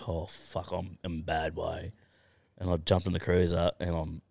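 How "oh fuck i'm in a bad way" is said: low-key, slow, soft hungover voice; retold hungover groan. Waking in his room after a big night, soft hungover voice. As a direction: mumble softly as if badly hungover. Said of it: "wrote myself off something fucking chronic".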